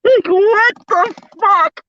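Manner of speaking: high, slow, stunned exclamation; can't believe what you just read; shocked disbelief. Real, not acted. Stunned by the casting news.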